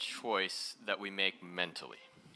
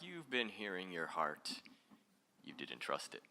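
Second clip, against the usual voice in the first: softer, lower and slower reply; gentle answer; 5 semitones lower, 6 dB quieter than usual. Retold or imitated. Teacher gently answers students, softer and slower. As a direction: answer them calmly and gently. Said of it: "I'm like".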